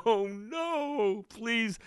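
high, soft pleading whimper; mock terrified plea. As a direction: beg like a scared recruit.

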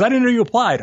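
wife finds out her husband applied without telling her; shocked wife. Retold or imitated. Wife learns he applied secretly.

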